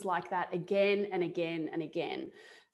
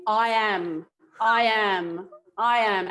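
steadily louder chant of I am; channelled voice; 7 dB louder, slower than usual. Retold or imitated. Telling a friend how the spirit voice spoke, a rising chant. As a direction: let a voice take over and chant it, each time louder. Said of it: "this voice take over my voice".

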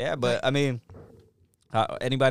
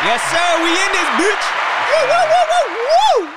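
high, loud whooping; hype hollering; 12 dB louder than usual. Real, not acted. Cohost cheers the show's opening, high whooping hype. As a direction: whoop it up like a rowdy crowd. Hyping up the show intro.

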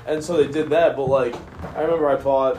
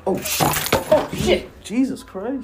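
startled; 11 semitones higher, 3 dB louder, slower than usual. Retold or imitated. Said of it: "I was like".

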